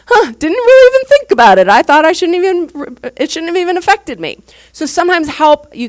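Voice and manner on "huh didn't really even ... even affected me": higher, louder, amused sheepish realization; quoting the friend half laughing at herself; dawning realization. Retold or imitated. Friend admits the loss affected them, amused and sheepish.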